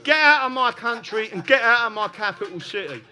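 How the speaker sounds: loud, high rallying shout; defiant shout. Acted. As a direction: order them out at full volume for the crowd. Rousing imagined order to Parliament.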